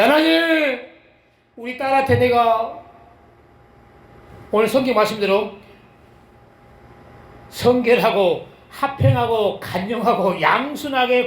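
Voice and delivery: fervent prayer. Retold or imitated. Quoting his own prayer.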